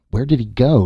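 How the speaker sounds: annoyed he wandered off, snap it a touch; irritable. A narrator's reading. Nasha annoyed that Tance vanished behind the gun, irritable. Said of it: "Nasha said irritably".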